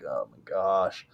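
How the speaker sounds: slow, hushed oh my gosh; quiet, drawn-out amazement; awed hush. Retold or imitated. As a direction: breathe it out in disbelief. Finding the moose again.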